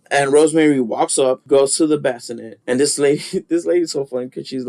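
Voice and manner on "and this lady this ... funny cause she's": ordinary talk, nothing marked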